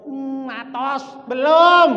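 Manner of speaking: teriakan orang Jawa. Acted. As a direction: act out a worker calling back, building to a yell. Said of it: "kata orang Jawa". (teriakan tukang Jawa yang melengking)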